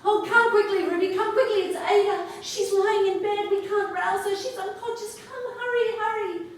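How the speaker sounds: high, loud, urgent pleading; the groom begging Ruby to come, high and frantic; panicked groom. Acted. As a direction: beg her to hurry, voice up high. Groom begs healer to come.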